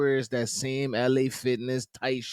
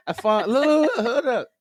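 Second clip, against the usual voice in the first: high and louder, still half laughing; excited, laughing; 12 semitones higher, 7 dB louder than usual. Real, not acted. Host cutting through his cohost's laughter, higher and louder, still laughing. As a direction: fight through laughter to regain the floor. Laughing, trying to regain composure.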